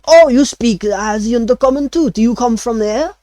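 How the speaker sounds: high, surprised French chef; a delighted chef asking in French accent. Acted. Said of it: "he still speaks in a French accent".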